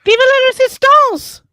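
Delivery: loud, high rebel cheer; a big theatrical rebel cheer; rallying cry. Acted. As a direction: shout it like a revolutionary. Player cheers rebel slogan.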